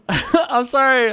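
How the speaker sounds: high voice breaking into real laughter; laughing apology. Real, not acted. Apologising to a Texan viewer for the bad accent, laughing.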